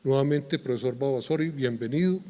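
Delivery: ordinary talk, nothing marked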